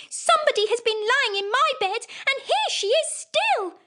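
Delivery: very high, tiny squeaky voice; little wee bear voice. Acted. Wee bear wails his chair is broken, very high. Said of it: "in his little wee voice".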